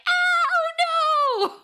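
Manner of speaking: high mock wail of alarm; drawn-out, squealed cry of dismay; Hummy. Host mocks a boaster when the monsters show up, high panicked wail. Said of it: "of course, Hummy's like".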